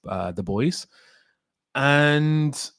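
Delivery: normal talking voice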